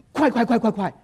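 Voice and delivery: high, rapid-fire repetition; urging haste. Acted. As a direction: hurry someone along impatiently. Acting out impatience for quick growth.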